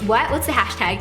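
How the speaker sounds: normal talking voice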